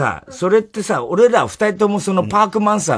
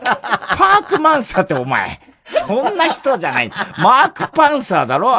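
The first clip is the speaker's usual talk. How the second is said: mocking partner's insistence; 6 semitones higher than usual. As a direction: ham up the partner insisting it's マークパンサー. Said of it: "とかなんか言ってさ".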